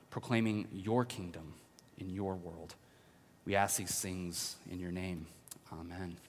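soft, hushed pastor's prayer. Acted. Pastor leading the church in prayer, hushed and reverent. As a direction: pray quietly over the congregation. Opening prayer before the message.

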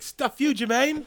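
high, loud Kiwi squeal; mock Murray shrieking at Jermaine; Murray impression. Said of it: "my favorite one is when Murray says".

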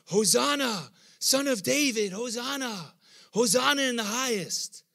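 high, loud hail of a cheering crowd; crowd's joyful cry. Retold or imitated. Preacher voicing the Jerusalem crowd, loud high joyful shout. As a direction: belt it out like the crowd at the gate. Crowd hails Jesus on donkey.

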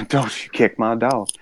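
a light Southern drawl, laughing into it; a soft, half-laughed Southern accent. Retold or imitated. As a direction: drop into a Southern twang for a quick quip. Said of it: "I'm picking up a little bit of a drawl".

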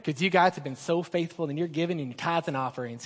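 lightly put-on voice, low and soft; Rick. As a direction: gently mimic a colleague thanking the givers. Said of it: "that's how he sounds in my head".

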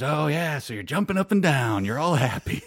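a lightly voiced sneering stranger; sneering tweaker. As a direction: jeer at his joy like a twitchy stranger. Gunman mocking the happy fan.

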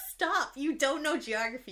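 loud, high-pitched mock scolding; playfully fed-up outburst; mock exasperation. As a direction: retell an exasperated plea to stop. Retold scolding of her husband.

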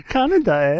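high put-on Canadian voice; mock Canadian accent. Host echoing the Canadian bit, mock Canadian accent. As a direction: squeeze it up high, cartoon Canadian. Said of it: "the Canadians were like".

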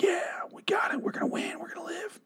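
a squeaky whispered cheer; mock whisper. Mocking the characters celebrating, high squeaky whisper.

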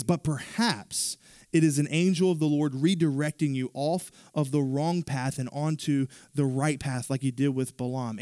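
usual speaking voice

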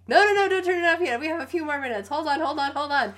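high, loud, fast begging; pleading students. Retold or imitated. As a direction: beg the teacher not to stop. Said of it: "We just are all like".

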